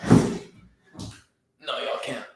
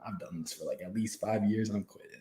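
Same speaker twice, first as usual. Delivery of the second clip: quiet retold quitting; 3 semitones lower, 11 dB quieter than usual. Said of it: "So I was like".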